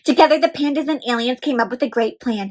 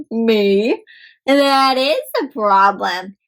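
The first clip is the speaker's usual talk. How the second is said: usual speaking voice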